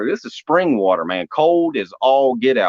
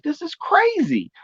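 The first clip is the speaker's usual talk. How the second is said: incredulous. Retold or imitated. Reacting to divine instruction.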